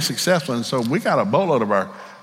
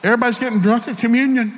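sheepish church member; 9 semitones higher than usual. Acted. Church member reporting the problem.

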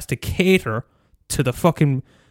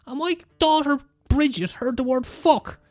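hammed-up fussy parent, much higher; mock outraged parent; 14 semitones higher than usual. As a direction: squeak it like a clutching-pearls mum. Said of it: "'cause, like".

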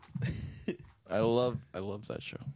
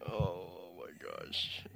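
slow quiet groan; 4 semitones lower, 11 dB quieter, slower than usual. Real, not acted. Overwhelmed groan.

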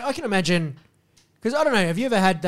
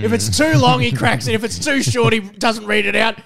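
higher and louder, teasing rhythm; excited teasing; 6 semitones higher, 7 dB louder than usual. Acted. Mate teases host's picky reading rule, excited and loud. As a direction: rattle off the rule in glee.

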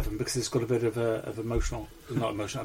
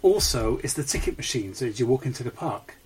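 usual speaking voice

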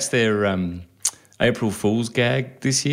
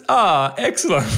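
higher-pitched pretend satisfaction; mock delight; 6 semitones higher than usual. Customer learning the bill was fake, mock delighted. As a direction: play the suddenly pleased customer. Imagined customer's pleased reaction.

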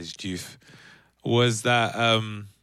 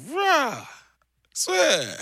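astonished; 4 dB louder than usual. Retold or imitated. Astonished reaction.

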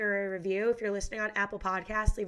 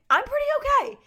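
excited; 7 dB louder than usual. Real, not acted. Plants thriving, mood fine.

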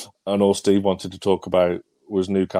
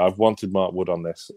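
ordinary delivery

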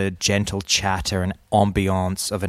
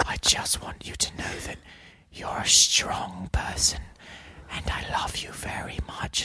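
whisper; 8 dB quieter than usual. Retold or imitated. Retelling an ASMR video to a friend, whispered. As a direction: hush it like a soothing video voice.

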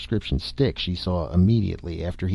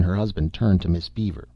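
plain reading voice

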